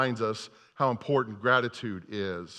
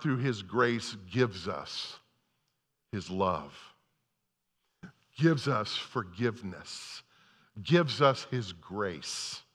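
reverent softness; 6 semitones lower, 5 dB quieter, slower than usual. Real, not acted. Preacher slows into reverent softness.

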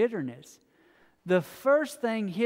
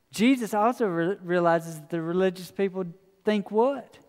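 ordinary talk, nothing marked

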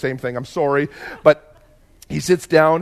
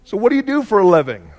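cheery nosy question; 5 semitones higher, 5 dB louder than usual. Retold or imitated. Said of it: "that question that I hate".

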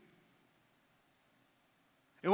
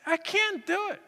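anguished refusal; 8 semitones higher, 4 dB quieter than usual. Retold or imitated. Father refuses to kill son.

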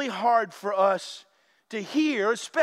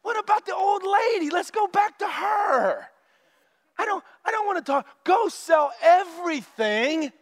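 exasperated protest; 5 semitones higher than usual. Retold or imitated. Churchgoers begging Jesus to change the subject, rising exasperation. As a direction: whine to be taken back to the fun. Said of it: "we're like".

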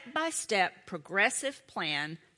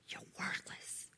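hushed, breathy whisper of a lie; devil's whisper; 16 dB quieter than usual. Acted. The devil whispering lies into the listener's ear, hushed. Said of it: "the lies he whispers in your ear".

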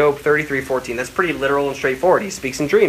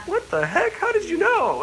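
high, amazed disbelief; 4 dB quieter than usual. Retold or imitated. Friend on the phone, stunned he knew, high amazed voice. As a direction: squeal out their astonishment.

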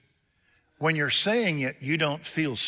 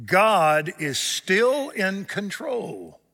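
higher, slow, every word weighted; slow emphatic point; 8 semitones higher, 5 dB louder, much slower than usual. Real, not acted. Preacher stressing his second point to the congregation, slow emphasis. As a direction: lean hard on each word. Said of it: "preacher's point, slow emphasis".

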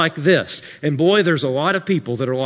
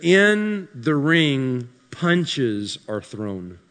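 slow, dropping mock-deep delivery; mock profound; much slower than usual. Shown as a demonstration. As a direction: fake profundity, drag it out. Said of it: "That's deep".

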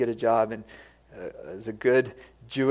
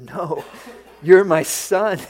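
slow, higher and louder, laughing with joy; joyful laughing reply; 6 semitones higher, 7 dB louder, slower than usual. Acted. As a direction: laugh away the apology, delighted. Father refuses son's apology.

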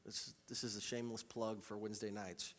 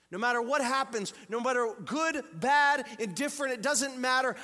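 full-force preaching; 12 semitones higher, 12 dB louder than usual. Acted. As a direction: preach it out to the whole hall.

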